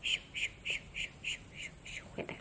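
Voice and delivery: whisper. Real, not acted. Whispering to the cat.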